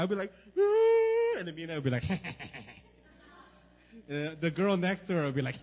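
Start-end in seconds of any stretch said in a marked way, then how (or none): 0.6-1.4 high, loud hammed-up wail; a comic sobbing howl; mock wailing cry. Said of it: "I would cry".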